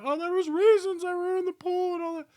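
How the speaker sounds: high, loud, whiny-viewer mimicry; mocking objector. Viewer defending the movie's pool scenes, mocked in a high voice. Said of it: "I'm not trying to be that guy because there's somebody's watching".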